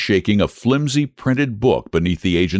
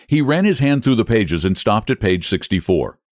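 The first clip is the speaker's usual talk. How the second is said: ordinary delivery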